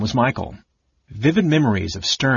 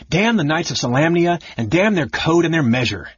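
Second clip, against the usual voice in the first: angry curse; 7 semitones higher than usual. Acted. Caramon's inner fury.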